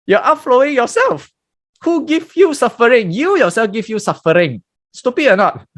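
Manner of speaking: high, emphatic teaching voice; preaching. Acted. Raised preaching point.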